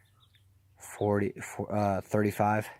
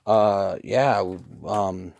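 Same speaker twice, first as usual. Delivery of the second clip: halting imitation of someone caught out; mimicked stuttering; 3 semitones lower, much slower than usual. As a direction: stall on fillers, stumbling into the words. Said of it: "he starts stuttering".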